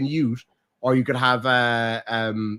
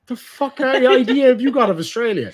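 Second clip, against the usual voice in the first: louder and higher, laughing as he asks; laughing disbelief; 9 semitones higher, 6 dB louder than usual. Real, not acted. Teasing his co-host about Australia, laughing in disbelief. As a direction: laugh out the outraged question. Mock outrage at odd guess.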